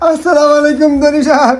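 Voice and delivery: tearful greeting through groans; put-on whimpering hello; feigned pain. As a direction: greet him as if badly hurt. Greets owner while faking injury.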